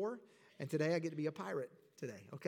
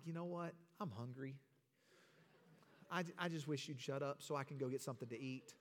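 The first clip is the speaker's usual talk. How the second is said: much softer private inner thought; inner grumble; 6 semitones lower, 11 dB quieter, faster than usual. Retold or imitated. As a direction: mutter the real selfish thought under your breath. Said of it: "But deep down, I'm like".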